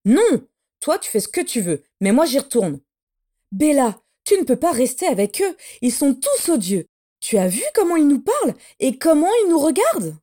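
lightly voiced pleading sister; character dialogue. A narrator's reading. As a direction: voice the younger sister urging her to leave. (voix de sœur qui supplie, à peine jouée)

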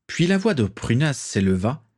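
ordinary delivery

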